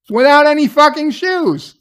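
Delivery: real disbelief climbing; real outrage peaking. Real, not acted. Speaker outraged at the scene.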